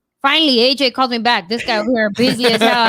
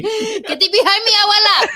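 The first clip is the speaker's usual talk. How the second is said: laughing mock rebuke; 11 semitones higher, 4 dB louder than usual.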